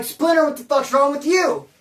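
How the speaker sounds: loud, high retold challenge; angry confrontation.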